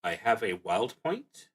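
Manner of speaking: ordinary delivery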